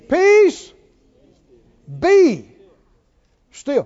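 loud, punched single words; high, forceful proclamation; commanding. Retold or imitated. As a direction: command the storm, word by word. Quoting Jesus rebuking the sea.

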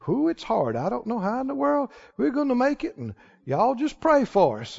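very soft, resigned mock complaint; imitated defeatist talk. Church member resigned to hardship asking for prayer, mock-defeated. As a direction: play a worn-down, helpless churchgoer. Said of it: "you can hear that talk at any church".

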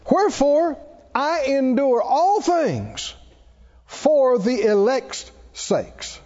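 slow, declaimed Bible verse with high openings; measured pulpit reading; scripture reading. Acted. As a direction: proclaim the verse with weighty, drawn-out words. Resumes reading scripture aloud.

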